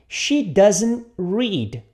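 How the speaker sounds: She doesn't read, drawn out word by word; very slow model. Shown as a demonstration. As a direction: stretch every word for the learner. (bem devagar, palavra por palavra)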